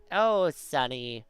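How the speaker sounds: put-on slow, drawn-out old lady rasp; raspy old person. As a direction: stretch it out like a doting elder. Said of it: "the raspy old man or old lady".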